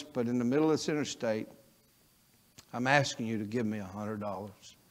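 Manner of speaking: soft, low, pleading prayer to God; hushed heartfelt roadside prayer; earnest prayer. Retold or imitated. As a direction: pray quietly and humbly, stranded and broke. Retold prayer on the interstate.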